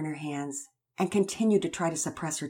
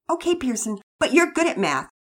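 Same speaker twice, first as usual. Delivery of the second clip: a bit harsh; 9 semitones higher, 7 dB louder than usual. Acted. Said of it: "Penelope sounded a bit harsh".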